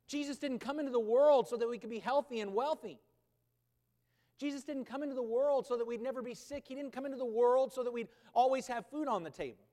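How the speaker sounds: loud, driving sermon delivery; a preacher hammering each line home; forceful preaching. Acted. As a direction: preach it out to the whole room.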